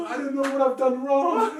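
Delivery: high, loud, drawn-out wail; hammed-up imitation of a tearful woman; mock crying. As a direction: wail it out slowly, making fun. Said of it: "She starts crying".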